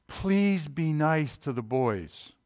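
slow, drawn-out mock pleading; measured, imploring request; wife's plea. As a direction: gently imitate a wife pleading, stretching the words. Retold wife's plea before work.

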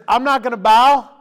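loud, high refusal; three young men defy the king with a loud shout; defiant shout. Acted. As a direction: throw the refusal back at the king.

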